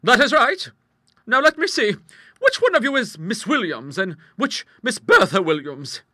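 high, loud, assured character voice; big-voiced matter-of-fact lady; strong-willed hostess. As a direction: explain it heartily and without concern. Hostess questions her visitors.

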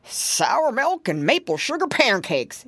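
mild yell. A narrator's reading. Grandpa pig seeing a bear at the window, mild yell. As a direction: exclaim it like a startled grandpa's oath. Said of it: "yelled Grandpa Squealer".

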